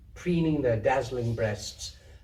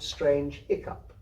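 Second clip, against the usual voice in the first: usual speaking voice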